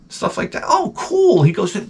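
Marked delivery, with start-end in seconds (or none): 0.6-1.4 excited clerk. Retold or imitated. Ross reacts to plants.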